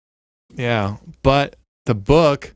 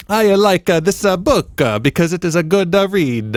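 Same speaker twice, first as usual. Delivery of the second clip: much higher, louder mock Italian accent; 7 semitones higher, 3 dB louder than usual. Shown as a demonstration. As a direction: exaggerate an Italian lilt through the ums. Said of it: "It's like a guy giving a speech".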